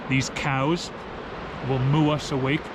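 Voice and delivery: usual speaking voice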